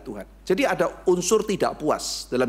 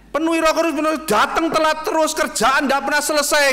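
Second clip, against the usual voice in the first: much higher, louder mocking rant; mocking outburst; 12 semitones higher, 5 dB louder than usual. Preacher mocking a late, lazy worker, loud and scornful. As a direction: scoff loudly at the excuse.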